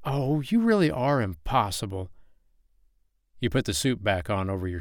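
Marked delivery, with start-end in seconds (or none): 0.0-2.1 exasperated tease. Acted. Playfully scolding his flirting.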